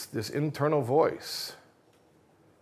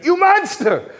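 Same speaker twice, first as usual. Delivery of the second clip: much higher, much louder furious yell; outraged scream; 10 dB louder, slower than usual. Acted. Audience member screaming at the murderer in court, furious scream. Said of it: "gets up, he screams again".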